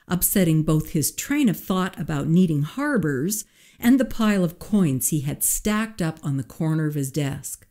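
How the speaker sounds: usual speaking voice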